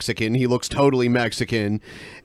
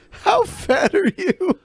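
very high, drawn-out mocking echo; mimicked stranger; slower than usual. Co-host parroting the stranger, high mock voice. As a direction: squeal the question slowly as a caricature.

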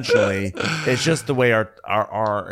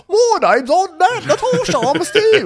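high, loud, strained fake British accent; a crazy clown stab at a British accent; overshooting a British accent, way up high; botched British accent; 8 dB louder than usual. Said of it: "sounding like, you know, crazy clown".

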